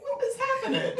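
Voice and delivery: high laughing disbelief; astonished. Retold or imitated. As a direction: squeal it out in amazement. Astonished quote of herself.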